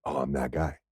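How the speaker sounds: low, hushed self-realization; stunned realization. Retold or imitated. Man realizing he was rude to a stranger, stunned and hushed. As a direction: let it sink in quietly, dropping low.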